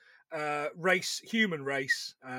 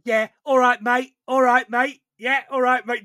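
louder, higher English geezer "all right, mate"; Mark Plant impression; 5 semitones higher, 9 dB louder than usual. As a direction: greet him like a cocky London lad. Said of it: "Doing, like, a really good Mark Plant impression".

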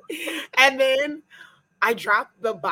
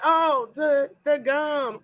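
mock shocked realisation; 5 semitones higher, much slower than usual. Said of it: "I was like".

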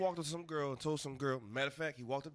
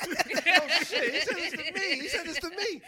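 high, loud, laughing insistence; squealing he said this to me; laughing disbelief; 12 semitones higher, 7 dB louder than usual. Real, not acted. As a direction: swear it's true while cracking up. Incredulous laughing retelling.

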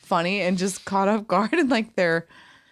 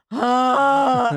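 one honk of a laugh; 4 semitones higher than usual. Shown as a demonstration. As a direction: let out a short honk. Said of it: "It's a honk".

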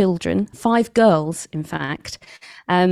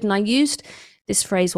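normal talking voice

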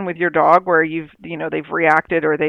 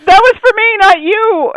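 much higher and louder, laughing it out; high excited laughing; 7 dB louder than usual. Real, not acted. Owner explaining the curse was for herself, high and laughing. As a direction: protest through laughter, high and loud. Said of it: "explaining the curse".

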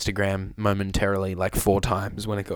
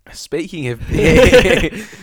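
laughing; 7 semitones higher, 8 dB louder, much slower than usual. Real, not acted. Laughing joke.

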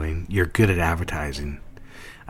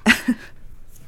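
laughs. Acted. Laughs on the phone.

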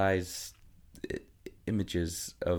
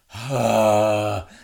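big exaggerated louder sigh; loud mock sigh; 4 semitones higher, 9 dB louder than usual. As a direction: heave a dramatic sigh of defeat. Son's heavy sigh.

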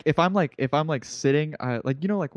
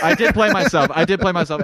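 laughing admission; 6 semitones higher, 7 dB louder than usual. Real, not acted. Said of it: "you played yourself by saying that".

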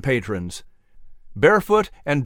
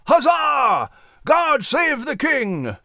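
much higher rowdy cheer; cheering shout; 10 semitones higher, slower than usual. Acted. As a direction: gentlemen pound the table and cheer. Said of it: "and shouted".

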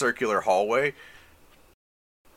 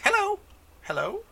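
fairy voice. Acted.

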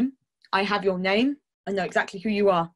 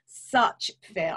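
usual speaking voice

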